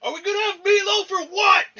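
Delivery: shouting from the far end of the room after putting the mic down. Pretend. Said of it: "I don't know if I can do a good impression of that".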